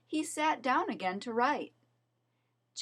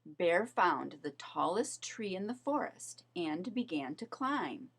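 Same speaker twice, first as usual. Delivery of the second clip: plain reading voice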